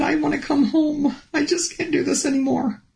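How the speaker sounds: retold crying plea to Jesus; tearful plea. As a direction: beg to go home through tears. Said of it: "I just started crying".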